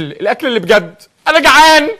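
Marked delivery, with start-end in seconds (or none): 1.3-1.9 loud, high hungry whine; whining. Acted. Starving husband whines to his dieting wife, loud and high. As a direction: complain like a starving child.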